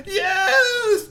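very high delighted shout; joyful cry. Acted. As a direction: scream yes with joy. Girl cries out joyfully.

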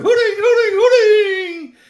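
high, loud, slow hooting; hooting word. Acted. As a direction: stretch the words into owl hoots. Owls all hooting.

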